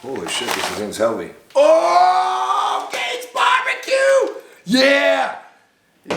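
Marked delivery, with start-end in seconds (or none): 1.6-5.4 very high, drawn-out cheer; high whooping delight; excited shout. Real, not acted. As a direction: holler it out, overjoyed by the gift. Thrilled on opening gift.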